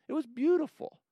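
normal talking voice

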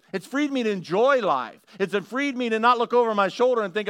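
rising, building preacher's fervour; preaching. Acted. Pastor to congregation on grace, building fervent preaching.

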